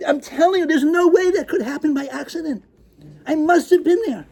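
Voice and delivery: frantic crazed shouting. Acted. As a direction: go fully unhinged. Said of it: "a crazed attitude in my voice".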